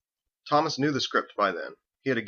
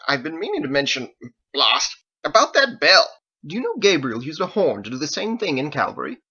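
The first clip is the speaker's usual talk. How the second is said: a hint of the pompous voice, louder; lightly mocking imitation; 5 semitones higher, 6 dB louder than usual. A narrator's reading. Harold teasing Thomas about the bell, light mock pomp. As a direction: tease with mock diction.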